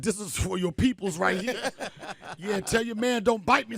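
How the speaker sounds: loud laughing taunt; mock gangster taunt. To the crabs he kills, a loud laughing revenge taunt. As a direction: taunt the crab like a movie gangster.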